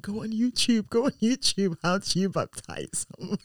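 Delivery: high, urgent pleading to husband; retold panic. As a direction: act out a flustered call for help. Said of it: "I was panicking".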